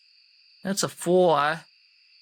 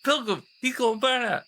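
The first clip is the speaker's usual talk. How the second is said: high, excited alarm; 8 semitones higher, faster than usual. Acted. Player alarmed that the pilgrim will burn them. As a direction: point out the danger in a rush.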